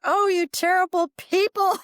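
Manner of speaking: very high, comically aggrieved wail; hammed-up indignant protest, pitch sky-high; mock outrage. Patient scolding family for pulling her from heaven, mock outrage.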